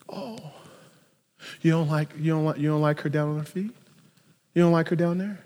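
soft, understated voice for Jesus; mild, quiet questioning. Retold or imitated. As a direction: ask it gently, low-key. Jesus gently answers the Pharisees.

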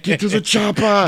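high-pitched comic action-star impression; Arnold impression. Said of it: "And Arnold's like".

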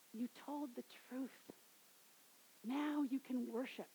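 quiet tender reassurance; gentle hush. Retold or imitated. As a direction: speak it softly, as a blessing. Jesus tells her gently.

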